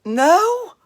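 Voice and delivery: high, loud startled no; a real surprised yelp of a no. Real, not acted. Host reacts to viewer saying it sounds country, surprised. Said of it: "It sounds country".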